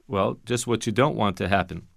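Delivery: normal talking voice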